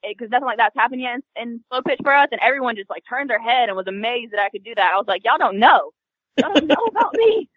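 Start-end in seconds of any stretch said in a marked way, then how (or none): 5.2-7.5 loud, higher boastful taunt; cocky brag. Retold or imitated. Softball player bragging to her teammates, cocky and loud. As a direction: show off like you just made the play.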